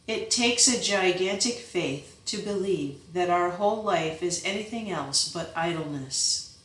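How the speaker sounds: plain reading voice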